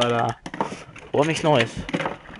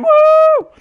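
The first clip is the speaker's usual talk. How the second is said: loud, very high whoop; long high-pitched cheer; excited woo; 10 dB louder than usual. Real, not acted. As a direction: let out a piercing celebratory woo. Gamer celebrating loudly.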